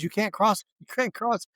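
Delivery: a hint of a woman warning a customer; imitating shop woman. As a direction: raise the pitch to mimic her objection. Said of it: "She goes".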